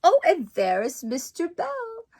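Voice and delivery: ordinary delivery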